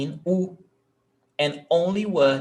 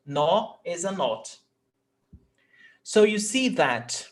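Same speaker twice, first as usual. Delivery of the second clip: ordinary delivery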